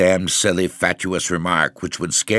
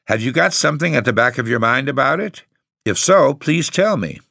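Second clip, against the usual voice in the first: quick, higher-pitched and irritated; irritable demand; 7 semitones higher than usual. A narrator's reading. Tenant pressing the caretaker to speak up, irritable. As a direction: press for an answer, testy and hurried. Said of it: "I cried irritably".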